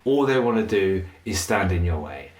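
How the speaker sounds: ordinary talk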